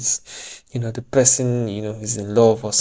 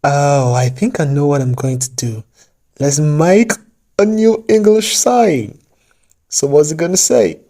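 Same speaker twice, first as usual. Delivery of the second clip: fake British accent drifting Indian; 3 semitones higher, 4 dB louder than usual. Vlogger inventing an English saying in a fake British accent. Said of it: "I think I'm now sounding more Indian than British".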